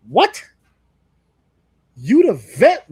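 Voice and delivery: loud, high disbelieving what; incredulous. Real, not acted. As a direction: bark it out in disbelief. Incredulous outburst at Jey.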